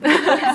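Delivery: a high, loud laugh that falls away; real amused laugh; burst of laughter. Real, not acted. Laughs at own aside.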